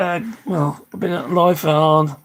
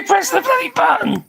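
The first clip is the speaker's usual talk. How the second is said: exasperated laughing shout. Real, not acted. Stuck stream won't end, a laughing, exasperated high shout. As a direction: yell it through laughter, fed up.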